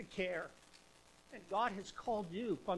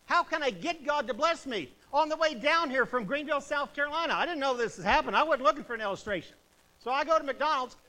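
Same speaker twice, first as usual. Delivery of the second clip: raised, fervent preacher voice; full-force preaching; 6 semitones higher, 9 dB louder than usual. Acted. Preacher urging the church to obey, at full force. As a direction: preach it to the congregation.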